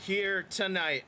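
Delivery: upbeat post-match recap voice; wrestling commentator. Acted.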